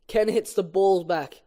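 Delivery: ordinary talk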